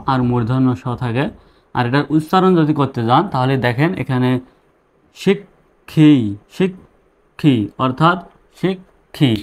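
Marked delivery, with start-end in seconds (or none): 5.2-9.3 "শিক্ষি" drawn out so the k and then the kh can be heard; sounding out the word. Shown as a demonstration. Teacher slowly sounds out "শিক্ষি" so students hear the k and kh. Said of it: "একটা ক আর খ উচ্চারণ হচ্ছে".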